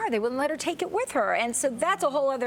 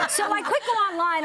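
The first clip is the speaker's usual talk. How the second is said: laughing; 8 semitones higher, 3 dB louder than usual. Real, not acted. Laughing through apology on air.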